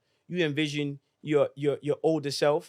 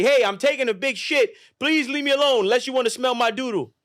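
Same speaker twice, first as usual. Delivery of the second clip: higher, louder jokey call-out; goofy excuse voice; 7 semitones higher, 5 dB louder than usual. Pretend. Kid telling the family to leave him alone, goofy call.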